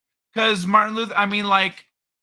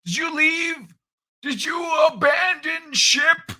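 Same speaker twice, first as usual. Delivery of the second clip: put-on high, loud old-man character voice; Old Man Kai voice; 9 semitones higher, 5 dB louder, slower than usual. Host ribbing his co-host, as a high, loud old man. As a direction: play the cranky old man, pitched up.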